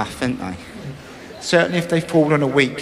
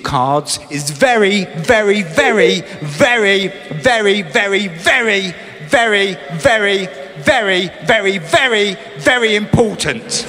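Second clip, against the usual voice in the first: a long rising run of very, each very pushed a little higher; very very stretched; 3 semitones higher than usual. Acted. Comic stretching the word very for the crowd. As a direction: pile up the verys like an endless list.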